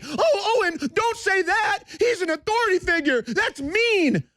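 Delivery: mock whiny critic. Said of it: "I wanna kick Bill de Blasio's ass".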